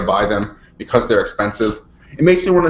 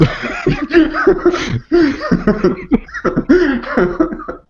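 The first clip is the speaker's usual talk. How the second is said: long squealing high laugh. Real, not acted. Co-host laughing at her own joke, high and long.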